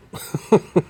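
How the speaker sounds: brief high, loud chuckle; short laugh. Real, not acted. Man who just found his repair mistake, short laugh.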